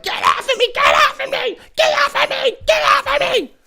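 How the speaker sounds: very high, squealed mock protest; tantruming child. Child being dragged inside by parents, screeching tantrum. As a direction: shriek like a kid being dragged away. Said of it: "the parents try to drag him, and they're like".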